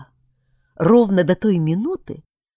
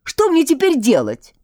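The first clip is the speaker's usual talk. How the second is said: higher, louder, pleading; worried prince; 8 semitones higher, 4 dB louder than usual. A narrator's reading. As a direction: whine it out at the witch. Albert asks, blue skin. (встревоженный княжич, выше и громче)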